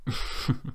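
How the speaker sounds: soft, short acted giggle; small laugh. A narrator's reading. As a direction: give a quiet little laugh. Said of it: "Doris laughed".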